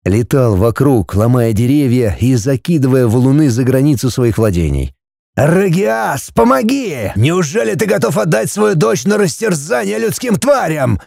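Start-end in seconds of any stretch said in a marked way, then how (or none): 5.3-11.0 desperate yell. Acted. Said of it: "проорал он в сердцах".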